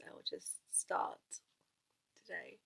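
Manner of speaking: soft whisper to save the voice. Real, not acted. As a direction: whisper it, sparing a sore voice. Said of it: "to save my voice".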